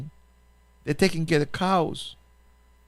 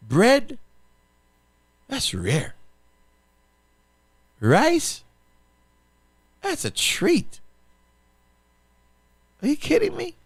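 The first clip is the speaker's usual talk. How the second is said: high, rising disbelief at each item; incredulous; 11 semitones higher, slower than usual. Real, not acted. Pastor telling listeners rice is a treat, incredulous and high. Listing foods that are rare.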